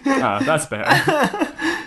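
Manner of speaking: high, slow, contented sigh of approval; satisfied Samantha. Acted. Samantha pleased with her filthy title, high slow sigh. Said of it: "Samantha satisfied with her version".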